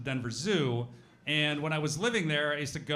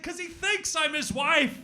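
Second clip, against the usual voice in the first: high, slow, laughing shout; 14 semitones higher, 5 dB louder, slower than usual. Retold or imitated. Punchline of the gorilla story for the hosts, shouted with a laugh. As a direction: yell the punchline through a laugh. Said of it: "And it was just like".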